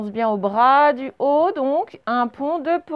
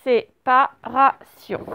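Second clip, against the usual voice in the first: slow, detached syllables for dictation; 3 dB louder, much slower than usual. Shown as a demonstration. Teacher repeats the word for pupils, syllable by syllable. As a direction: repeat it slowly, syllable after syllable. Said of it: "On fait syllabe par syllabe".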